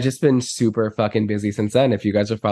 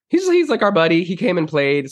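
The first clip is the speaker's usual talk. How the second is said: excited high voice; 8 semitones higher than usual. Real, not acted. Excited story about buddy.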